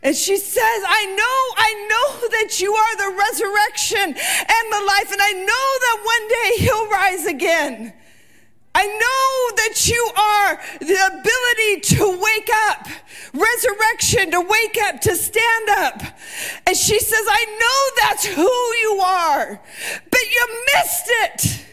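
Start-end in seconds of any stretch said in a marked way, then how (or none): 0.9-16.0 frustrated Martha. Acted. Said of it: "And Martha is mad". 17.3-21.6 frustrated Martha. Acted. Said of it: "She's frustrated".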